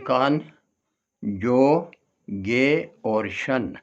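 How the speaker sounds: कान, जो, गे, शन as separate beats; syllable by syllable. Shown as a demonstration. Teacher pointing out four syllables, one by one. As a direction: pause after each of कान, जो, गे. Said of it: "इसमें syllable देखिए".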